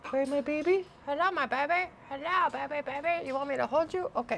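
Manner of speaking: high sing-song pet talk. Real, not acted. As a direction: coo at the bird sweetly. Cooing to pet bird.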